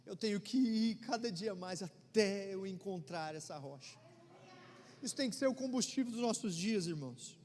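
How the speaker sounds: full-force preaching. Acted. Pastor exhorting the congregation about rescue, full-force preaching.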